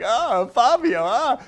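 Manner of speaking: high, slow mock swoon; swooning over Fabio. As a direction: gush over a heartthrob in a high voice.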